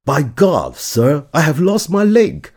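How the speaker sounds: high, loud startled cry; exclaiming in shock. Retold or imitated. Earl crying out to Wellington, high loud shocked exclamation. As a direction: blurt it out like you've just been hit. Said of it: "就惊呼".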